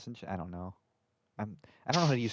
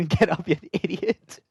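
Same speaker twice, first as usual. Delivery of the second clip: louder taunting yell; mocking shout; 7 semitones higher, 8 dB louder, slower than usual. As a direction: jeer at them loudly. Mocking a character in game.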